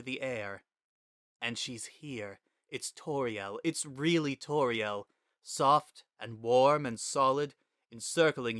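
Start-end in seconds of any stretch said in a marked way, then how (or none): none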